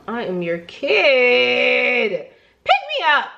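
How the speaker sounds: put-on pleading like a kid; whiny plea. Teen pleading with mom.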